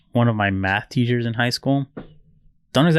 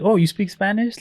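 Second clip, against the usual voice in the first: mock surprised friendliness; 10 semitones higher than usual. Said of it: "immediately changed their tone".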